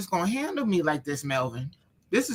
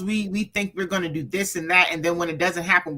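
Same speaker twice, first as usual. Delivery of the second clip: normal talking voice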